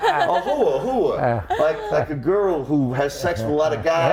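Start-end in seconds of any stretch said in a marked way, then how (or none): 0.0-1.5 "hooah" drawn out with no r; insisting on "hooah", r dropped; Jersey "hooah" repeated. Retold or imitated. A Jersey student repeats "hooah" to confused classmates. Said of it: "like I speak a foreign language".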